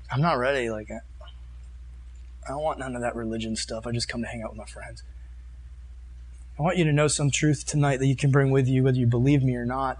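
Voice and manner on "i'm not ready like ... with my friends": low, soft imitation of a reluctant kid; mock reluctance. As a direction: lightly act out someone backing away, voice dropping. Acts out reluctant young listener.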